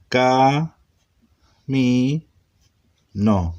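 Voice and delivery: Ca, mi, no stretched, a pause after each; syllable by syllable. Shown as a demonstration. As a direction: read Ca, mi, no slowly as consonant-vowel pairs. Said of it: "Así es como se lee en hebreo". (Ca, mi, no leído sílaba por sílaba, con pausas)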